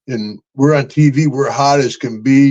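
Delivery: ordinary talk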